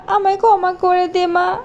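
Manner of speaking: usual speaking voice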